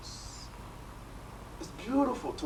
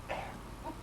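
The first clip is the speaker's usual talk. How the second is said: breathless whisper; 13 dB quieter than usual. Retold or imitated. Breathless remembered answer.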